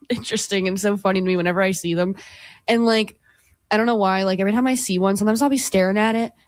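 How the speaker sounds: ordinary delivery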